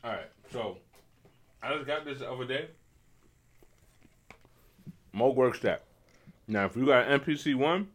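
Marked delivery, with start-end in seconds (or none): 1.6-7.9 talking around a piece of ice; words round a mouthful of ice; mouth full. Real, not acted. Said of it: "excuse me, I'm eating a piece of ice".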